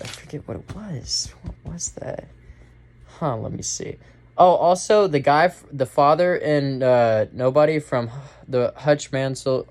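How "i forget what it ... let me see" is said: muttering to himself. Real, not acted. Muttering to himself mid-review.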